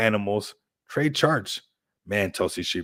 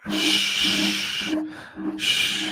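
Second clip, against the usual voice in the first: long airy "shh" into the mic; drawn-out shush. Acted. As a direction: hush everyone with slow shushes. Acting out shushing for ASMR.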